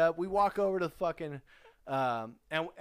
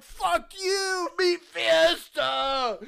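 much higher, much louder shout, slowed on Meat Fiesta; drunken yell; 14 semitones higher, 9 dB louder, much slower than usual. Retold or imitated. Mock-shouting at roommates while trashing the hotel room, loud and high. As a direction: bellow it like a rowdy drunk taunting.

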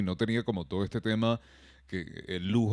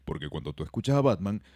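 ordinary talk, nothing marked

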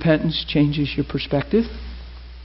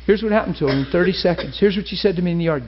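ordinary talk, nothing marked

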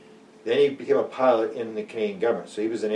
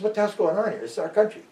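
much higher, indignant outburst; outraged complaint; 10 semitones higher than usual. Retold or imitated. Investigator complaining to Ottawa, outraged and higher. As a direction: demand answers, affronted.

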